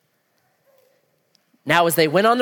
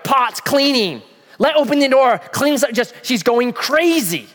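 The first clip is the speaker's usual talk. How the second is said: frantic; 7 semitones higher, 4 dB louder than usual. Acted. Martha frantically running around.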